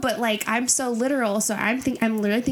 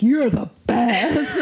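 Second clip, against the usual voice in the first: gushing; 3 semitones higher, 5 dB louder, much slower than usual. Retold or imitated. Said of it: "Everyone's like".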